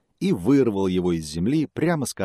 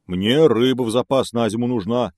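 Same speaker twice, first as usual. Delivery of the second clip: bear voice; 4 semitones higher, 7 dB louder than usual. Acted.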